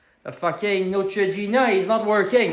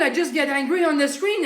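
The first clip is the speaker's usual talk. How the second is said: ordinary talk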